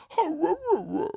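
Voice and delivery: high silly pet noise; animal voice. Retold or imitated. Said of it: "I always go".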